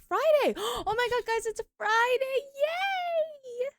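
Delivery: high, loud Friday cheer; excited cheer. Real, not acted. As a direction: squeal it out with joy.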